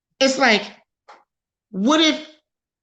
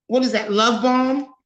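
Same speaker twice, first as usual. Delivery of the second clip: usual speaking voice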